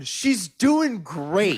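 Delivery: high, very slow, overly cheerful; eager mock reply. Answering a stranger about his mom, high and slow. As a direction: play along like an old friend. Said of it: "I immediately start going".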